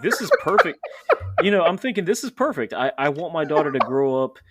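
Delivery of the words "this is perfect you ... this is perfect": high and loud, thrilled with the find; proud dad sure he's nailed the nursery gift; excited. Retold or imitated. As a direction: gush it like you found the perfect gift. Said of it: "to me, I'm like".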